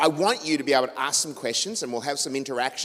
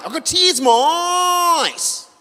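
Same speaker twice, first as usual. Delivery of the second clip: much higher, slow, drawn-out shout; teary trucker shouting; 9 dB louder, much slower than usual. Retold or imitated. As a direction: bellow it slowly like he's overwhelmed. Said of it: "And he goes".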